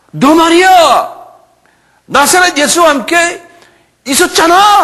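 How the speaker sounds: very high, loud, drawn-out accusation; accusing shout. Acted. Soldier accuses Peter of being with Jesus, accusing shout. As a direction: shout it in his face, stretched out.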